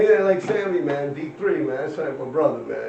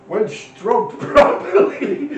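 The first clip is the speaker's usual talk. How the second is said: laughing; 7 semitones higher, 9 dB louder, much slower than usual. Real, not acted.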